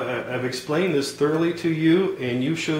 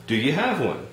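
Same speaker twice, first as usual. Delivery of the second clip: ordinary talk, nothing marked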